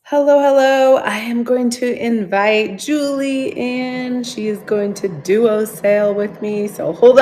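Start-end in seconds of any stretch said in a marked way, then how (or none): none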